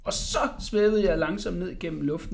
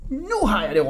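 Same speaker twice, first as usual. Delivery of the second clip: raised, louder eureka cry; excited shout; 5 semitones higher, 5 dB louder than usual. A narrator's reading. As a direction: call out a sudden idea. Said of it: "råbte Jakob".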